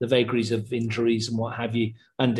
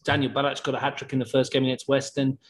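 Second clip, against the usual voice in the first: usual speaking voice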